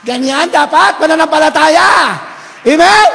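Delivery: high, full-force preaching; shouted exhortation. Real, not acted. Preacher urging congregation toward faith, shouting. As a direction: drive it up to a loud Amen.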